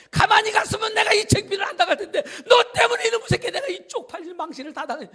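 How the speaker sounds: loud, high, half-laughing rant; furious yelling. Acted. Naaman yelling at the servant over his shame, furious. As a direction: blow up at the servant.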